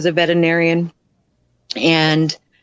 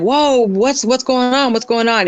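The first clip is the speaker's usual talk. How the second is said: higher, startled bystander reaction; alarmed, pitched-up questioning; retold alarm; 7 semitones higher than usual. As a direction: imitate men caught off guard. Startled men react in retold attack.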